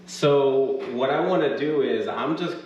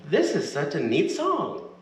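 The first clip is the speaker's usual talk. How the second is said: gentle librarian voice; 8 semitones higher than usual. Acted. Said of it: "Chasta's gentle, librarian-like voice says".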